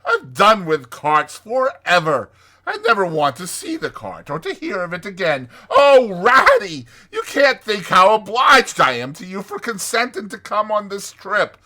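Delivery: high, loud, swooning Toad; theatrical, soaring rapture; rapturous Toad. Acted. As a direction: gush ecstatically, savoring each marvel.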